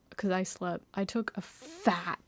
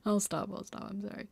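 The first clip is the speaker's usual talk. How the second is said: usual speaking voice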